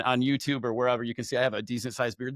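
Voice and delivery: ordinary delivery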